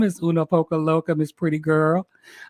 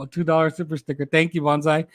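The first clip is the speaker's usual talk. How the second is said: ordinary talk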